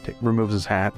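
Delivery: ordinary delivery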